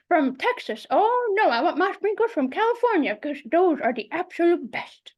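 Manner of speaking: lightly voiced fussy grandmother, rising indignant on oh no; grandmother's voice. Speaker voicing her grandmother on ice cream, fussy and emphatic. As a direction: play a particular old grandmother holding forth. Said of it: "she would tell you".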